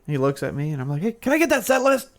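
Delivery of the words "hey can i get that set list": high-pitched request, voice not raised; mock calling out. Fan re-enacting his ask for the set list, high mock call. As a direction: imitate calling up to someone on stage.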